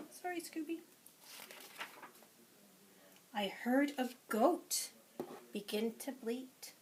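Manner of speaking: lively, sing-song picture-book read-aloud; reading to children. Acted. Teacher reading a picture book to preschoolers, lively and sing-song. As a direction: read it to a class of little ones.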